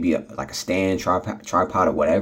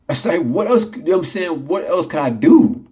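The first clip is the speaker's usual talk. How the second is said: high-pitched, helpless questioning; exasperated pleading; 9 semitones higher than usual. Real, not acted. Vlogger at a loss about getting rid of flies, high exasperated plea.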